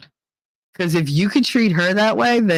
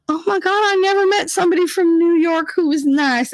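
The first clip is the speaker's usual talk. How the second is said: put-on gushing old lady; 11 semitones higher than usual.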